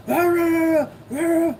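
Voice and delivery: high, hammed-up ranting rah rah; mock yelling. Streamer mocking a politician who yells, high mock ranting. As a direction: parody a man who always yells. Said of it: "Him fucking yelling all the time".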